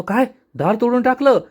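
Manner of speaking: shocked question. Acted. Yashoda hears the door was broken, shocked. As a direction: gasp it out as if the door is gone. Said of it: "यशोदाला मोठा धक्काच बसतो".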